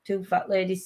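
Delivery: usual speaking voice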